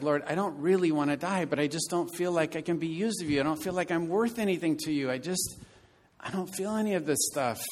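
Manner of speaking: put-on a broken teenager begging, voice rising; tearful plea. To Jesus in his daydream, a tearful rising plea. Teen self pleading with Jesus, weeping.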